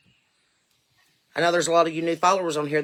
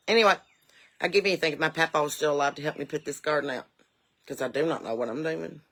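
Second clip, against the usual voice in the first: ordinary delivery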